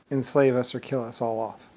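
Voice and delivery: usual speaking voice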